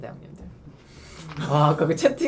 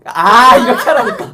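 loud laughing yell; 4 semitones higher, 12 dB louder than usual. Real, not acted. Friend laughing, yelling at another.

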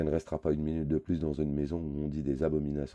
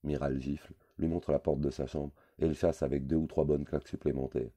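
ordinary delivery, nothing marked

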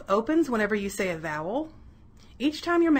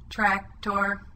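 monotone robot with separated syllables; two equal robot beats on one note; robot speak. Shown as a demonstration. Teacher shows pupils robot speak, monotone chopped syllables. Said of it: "say these words like the robot".

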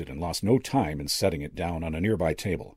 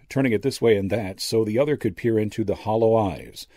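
ordinary delivery, nothing marked

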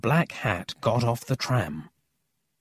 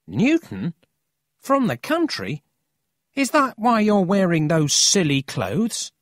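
put-on high, teasing boyish questions; mocking disbelief; 8 semitones higher, 3 dB louder than usual. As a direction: poke fun at the newcomer. Boy mocks Emil's town and clothes.

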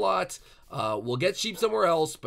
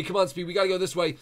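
ordinary delivery

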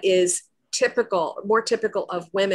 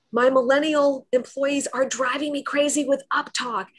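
exasperated; 6 semitones higher than usual. Retold or imitated. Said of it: "and he said".

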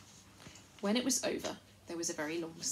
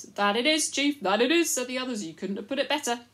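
higher, loud echoing chorus; loud fawning chorus; eager loud agreement; 6 semitones higher, 10 dB louder than usual. Acted. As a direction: agree loudly like flatterers.

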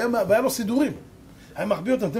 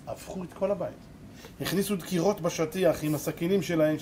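usual speaking voice

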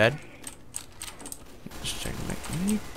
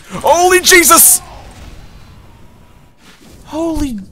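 much higher, louder, drawn-out shriek; shocked yelp; 14 semitones higher, 9 dB louder, slower than usual. Real, not acted. Gamer startled by a sudden enemy, shrieking in shock. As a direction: scream it in total disbelief. Sudden surprise death in game.

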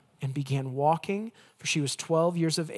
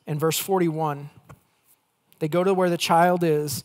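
ordinary delivery

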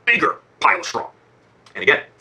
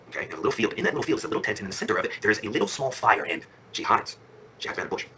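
soft, lowered suspense narration; hushed storytelling; 4 semitones lower, 8 dB quieter than usual. A narrator's reading. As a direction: tell it low and soft, as if hiding. Princess hides, secret scene in story.

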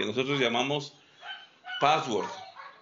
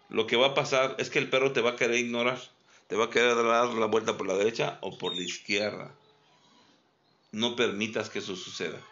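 usual speaking voice

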